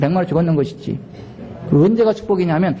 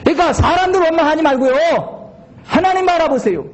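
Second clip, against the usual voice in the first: preaching at full force; 10 semitones higher than usual. Acted. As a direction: preach it with fire. Preaching exhortation, shouted.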